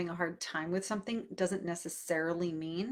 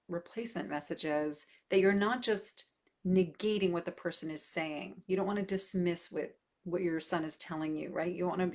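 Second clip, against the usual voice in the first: normal talking voice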